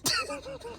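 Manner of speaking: squeaky high cackle at his own joke; high laugh. Real, not acted. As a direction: burst into a high giggle.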